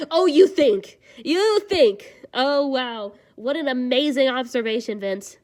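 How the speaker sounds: loud sarcastic jab; mock the obvious loudly. Real, not acted. Sarcastic outburst at character.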